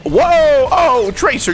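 sudden high yelp of surprise; startled yell. Real, not acted. Surprised yell as Tracer catches him. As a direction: caught off guard, yelp out loud.

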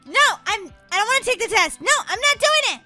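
high, protesting whine; whiny refusal. Pretend. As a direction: plead out of a bad dream. Pretends dreaming of a test.

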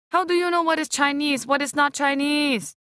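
high, exasperated rebuke; exasperated aunt. A narrator's reading. Auntie Lindo rebuking her daughter, high and exasperated. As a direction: put her daughter in her place.

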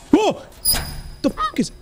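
high startled yelp. Real, not acted. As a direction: jolt in sudden shock. Startled by something in the game.

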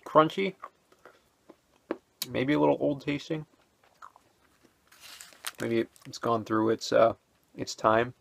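talking while chewing; chewing between words; mouth full. Real, not acted. Seen 1: Holds bitten bar, lips working closed between words, just tasted.

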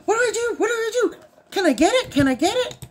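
high, frantic repeated questions; excited. Retold or imitated. Wife imitates excited husband, high and frantic. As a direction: pester like a giddy child. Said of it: "And he's all excited".

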